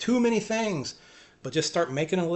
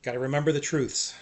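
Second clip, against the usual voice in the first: ordinary talk, nothing marked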